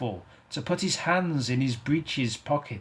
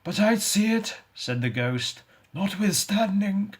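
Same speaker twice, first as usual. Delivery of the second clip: raised, eerie ghost voice; 8 semitones higher than usual. Acted. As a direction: speak as the ghost, high and hollow. Ghost speaking in the story.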